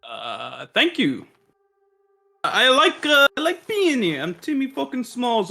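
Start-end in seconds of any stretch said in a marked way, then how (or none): 2.4-5.5 a faint high put-on accent, mostly lost; Timmy Smalls tries his accent and slips; attempted character accent. Timmy Smalls brags to the doctor in a half-kept high accent. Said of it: "forgot how to do my accent".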